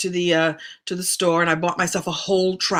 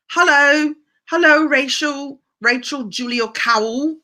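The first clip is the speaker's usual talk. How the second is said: higher greeting in a mock English accent; bad English accent; 5 semitones higher than usual. Host greeting a viewer from England in a badly faked English accent. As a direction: put on a hammy English hello. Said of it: "really bad English accent".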